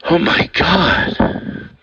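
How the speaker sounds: slow, breathy exhausted whisper; breathless whisper. Real, not acted. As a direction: gasp it out, out of breath. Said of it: "I got so excited".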